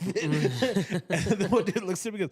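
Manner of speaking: breaking into laughter mid-sentence, pitch up; can't hold the laugh back. Real, not acted. Retelling funny Pepsi ad.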